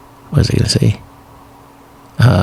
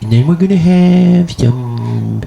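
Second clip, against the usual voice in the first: higher, boastful Trump riff; Donald Trump impression; 5 semitones higher than usual. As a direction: brag about the pie like Trump. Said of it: "I sound like Donald Trump".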